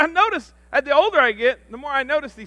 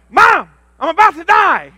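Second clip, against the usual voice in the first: higher, much louder frantic yell; mock panicked shout; 8 semitones higher, 13 dB louder than usual. Grown son calling his mother in a panic, shouting. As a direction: wail it down the phone. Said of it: "And I said".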